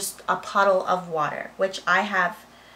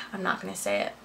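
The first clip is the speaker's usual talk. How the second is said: softer, muttered-under-breath repeat; quieter repeat; 7 dB quieter, much faster than usual. Real, not acted. Holding back gossip from viewers, softer repeat. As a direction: drop the level, say it again to yourself.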